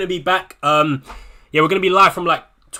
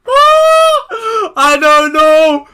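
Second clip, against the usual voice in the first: very slow, much higher, louder cry of despair; anguished wail; 10 dB louder, much slower than usual. Real, not acted. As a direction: stretch it into a desperate howl. Agonising over a defender pick.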